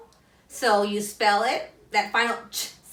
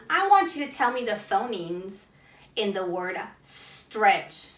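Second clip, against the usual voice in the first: usual speaking voice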